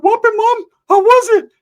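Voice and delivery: way up high and loud, cocky friend bragging; loud high cartoonish bro voice; high-pitched Ricky voice. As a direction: squeak it out like a boastful buddy. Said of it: "Ricky's like".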